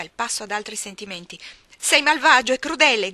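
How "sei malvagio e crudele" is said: loud, high furious shout. Acted. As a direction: scream it at him in rage. (urlo furioso, alto e forte)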